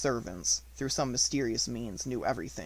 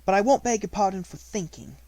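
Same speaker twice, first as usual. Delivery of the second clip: put-on firm young girl; 7 semitones higher, 5 dB louder than usual. A narrator's reading. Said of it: "she said then".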